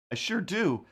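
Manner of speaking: a bright, eager reply; eager yes. Real, not acted. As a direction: answer the TV keenly. Answering the TV prompt.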